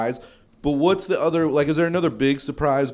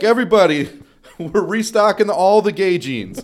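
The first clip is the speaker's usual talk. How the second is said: loud, excited pretend announcement to shoppers; mock store announcement; 5 semitones higher, 7 dB louder than usual. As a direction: announce it loudly like delighted shop staff. Acting store staff's gleeful announcement.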